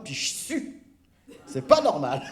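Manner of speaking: quoting her through laughter; laughing quote. Retold or imitated. Retelling the twin feeling the heat, laughing. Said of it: "elle dit".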